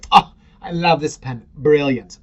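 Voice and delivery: loud, hammed-up British accent; mock British. Someone praising a pen at dinner, loud mock British accent. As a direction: gush over a pen in a fake British accent. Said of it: "If you're not British".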